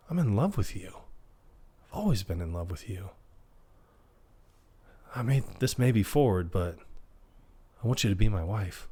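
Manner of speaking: soft, hushed mock declaration of love; sincere-sounding murmur; tender confession. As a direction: imitate a bashful proposal, quietly with pauses. Imitates Phil's love confession, proposal.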